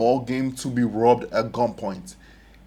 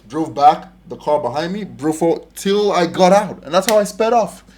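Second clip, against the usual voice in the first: ordinary delivery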